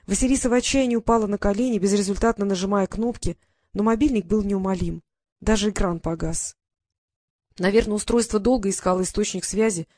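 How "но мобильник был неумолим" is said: ordinary delivery, nothing marked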